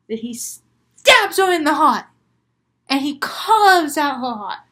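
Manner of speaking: loud, punched-up horror telling; loud, emphatic storytelling climax; dramatic gory climax. A narrator's reading. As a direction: hit the violent verbs hard and loud.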